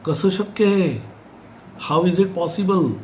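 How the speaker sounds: mildly dramatized shock; disbelieving husband. Acted.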